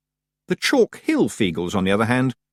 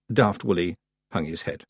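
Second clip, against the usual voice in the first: ordinary delivery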